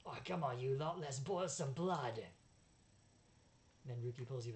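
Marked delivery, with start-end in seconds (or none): 0.1-2.2 loud, bad British accent, rallying; rally the gang in a hammed-up British accent; Barker. Barker eggs his pack on, bad British accent. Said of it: "I relish my intentionally bad British accent".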